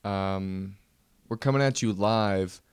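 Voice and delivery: ordinary talk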